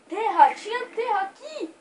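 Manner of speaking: loud, high excited cry. Real, not acted. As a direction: shout the find with delight. Finds dirt, excited.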